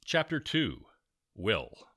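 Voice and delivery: usual speaking voice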